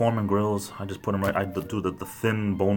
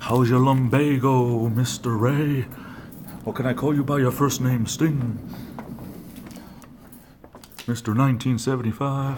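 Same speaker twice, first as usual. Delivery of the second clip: mock formal teasing; 4 semitones higher than usual. Teasing a coworker with a mock-formal greeting. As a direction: tease him with a grand, drawn-out welcome.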